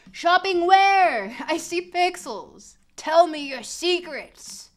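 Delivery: high, loud, slow hammy plea; dramatic pleading. Pretend. Streamer begging the blurry sign to reveal its secrets, hammed up. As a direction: beg the pixels like a mystic.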